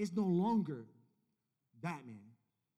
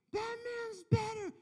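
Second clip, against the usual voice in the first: put-on crying child; slower than usual. Son crying over the Batman toy.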